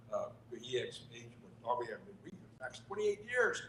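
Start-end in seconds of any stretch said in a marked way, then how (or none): none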